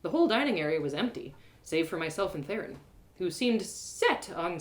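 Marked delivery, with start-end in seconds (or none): none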